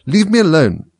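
high, indignant protest. A narrator's reading. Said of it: "said Alice indignantly".

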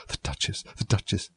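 very soft, breath-only fretful mutter. A narrator's reading. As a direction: mutter anxiously to yourself. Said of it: "heard it muttering to herself".

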